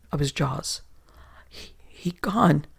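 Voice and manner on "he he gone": spluttering. A narrator's reading. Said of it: "the swarthy man spluttered".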